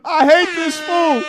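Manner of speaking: slow, loud mock rival voice; mock O'Shea voice. Streamer imitating a rival donor, a slow loud mocking voice. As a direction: lightly voice the rival hating on you. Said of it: "O'Shea is like".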